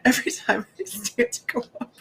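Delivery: high voice cracking with laughter; laughing through pain. Real, not acted. Woman laughing to her viewers about the pain. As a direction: laugh as she tells how much it hurt.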